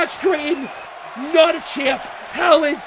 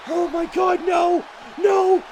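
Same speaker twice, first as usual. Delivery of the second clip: ordinary delivery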